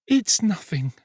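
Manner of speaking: put-on high-pitched young woman's voice; downcast girl. A narrator's reading. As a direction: brush it off in the girl's high voice. Weary Nancy brushes him off.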